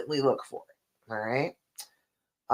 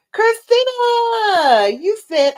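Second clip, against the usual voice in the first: much higher, louder, drawn-out name; greeting a commenter by name, thrilled and slow; excited callout; 7 dB louder, slower than usual. Real, not acted. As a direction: call her name out with delight. Calling out a commenter.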